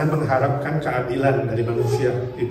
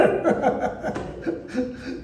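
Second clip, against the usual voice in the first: soft laugh; 9 semitones higher, 5 dB quieter than usual. Real, not acted. Laughs at own story.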